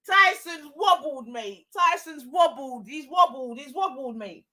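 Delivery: usual speaking voice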